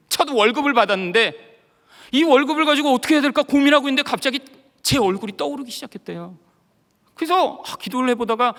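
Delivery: ordinary talk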